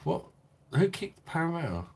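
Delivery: high-pitched, startled question; surprised demand. Real, not acted. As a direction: demand to know who did it. Learns a regular was kicked.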